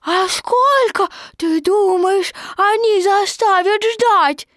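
put-on high, loud, drawn-out child; a small joey worried his stick is lost; squeaky fretful kid; little Roo voice. A narrator's reading. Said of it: "спросил Ру".

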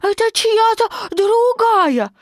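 put-on very high, loud excited child; a tiny joey fussing over sticks; squeaky eager kid chattering; little Roo voice. A narrator's reading.

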